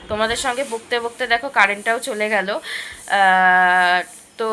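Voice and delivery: ordinary delivery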